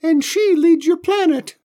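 very high, loud squeaky alien; tiny alien voice. Acted.

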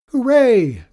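loud, high cheer of relief; excited cheer. A narrator's reading. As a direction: shout it out with joy. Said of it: "cried the old lady".

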